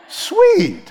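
high, loud-pitched squeal of approval; mock delight. Preacher imagines eating then sleeping right there, mock delight. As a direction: pitch it way up, playfully thrilled. Delighted at dinner bed idea.

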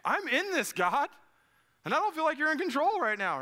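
high, loud cry to God; distressed plea. Acted. Struggling believer protesting to God, mock distressed. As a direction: plead at the top of your range, acted desperation. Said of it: "It's hard when you're like".

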